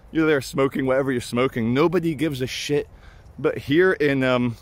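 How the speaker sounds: ordinary talk, nothing marked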